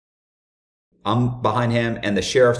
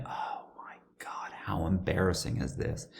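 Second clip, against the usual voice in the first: whispered thought; 3 semitones lower, 13 dB quieter than usual. Retold or imitated. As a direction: whisper it to yourself in embarrassment. Retold mortified private thought.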